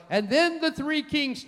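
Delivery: high, singsong mimicry; mock proclamation. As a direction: parrot the popular story in a high voice. Mimicking what people say, then corrects.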